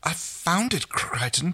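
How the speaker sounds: high-pitched startled excuse; lightly squeaked protest; quick squeak. A narrator's reading. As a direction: squeak it out in self-defence. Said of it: "Chiana squeaked quickly".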